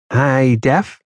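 nervous cop breaking the ice; mild shaky greeting to the mobster's bodyguard. A narrator's reading. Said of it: "he said in a shaky voice".

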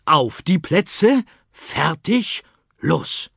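starting command. Acted. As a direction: call the start like a race official. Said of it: "gab das Kommando".

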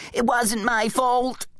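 high, drawn-out protest; panicked denial. Acted. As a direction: plead innocence in a high whine. Bum panicking, denying blame.

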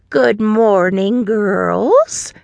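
put-on slow, sing-song old lady greeting; Nana's greeting. A narrator's reading. Grandmother greeting her granddaughters, slow and sing-song. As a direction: draw out a cheery hello to the girls.

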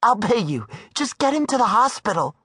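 high woman's voice, bargaining; pleading. Acted. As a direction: beg and offer money. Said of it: "while she pleaded".

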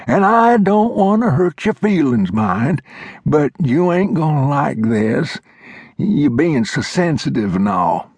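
put-on high, reedy old-timer lecturing; old mountain man voice. A narrator's reading. Old Glover scolding Flintlock, high old-timer voice. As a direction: play the cranky old coot setting him straight. Glover prepares bad news.

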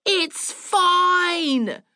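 high, long-held "fine". A narrator's reading. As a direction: holler it back, stretching out FINE. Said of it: "louder and louder".

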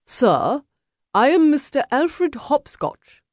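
high, slow, haughty introduction; disdainful. A narrator's reading. Meddler introducing himself to a duelist, disdainfully. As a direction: announce yourself with lofty disdain. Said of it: "lifted his chin disdainfully".

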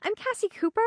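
put-on a shy small girl meeting a neighbour; little girl voice. Young girl introduces herself.

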